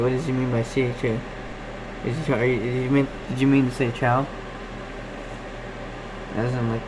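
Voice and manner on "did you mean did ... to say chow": ordinary talk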